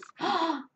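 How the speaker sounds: sharp high gasp of wonder; awed gasp. Real, not acted. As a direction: gasp at the sight of the card. Card reveal, awed gasp.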